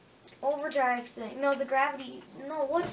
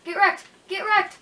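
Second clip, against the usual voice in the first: shouted gloating taunt, higher and louder; triumphant taunt; 6 semitones higher, 8 dB louder than usual. Real, not acted. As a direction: yell it in the enemy's face. Taunting enemy after kill.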